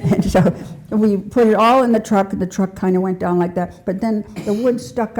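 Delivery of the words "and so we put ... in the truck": real laughter, louder, through the words; laughing. Real, not acted. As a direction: tell it while giggling for real. Laughing while telling story.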